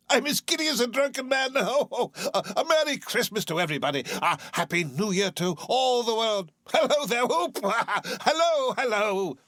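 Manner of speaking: put-on high, fast, laughing old man; giddy laughing Scrooge. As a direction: burst with giddy joy.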